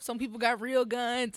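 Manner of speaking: ordinary delivery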